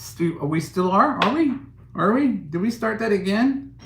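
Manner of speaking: feigning shock to a viewer about oppression; mock disbelief. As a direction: act shocked that slavery is back. Sarcastic disbelief at the accusation.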